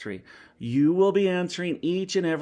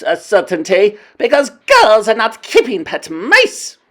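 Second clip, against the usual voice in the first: scornful witch queen; 9 semitones higher, 9 dB louder than usual. A narrator's reading. Grand High Witch lecturing her witches, scornful. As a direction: sneer each word with contempt.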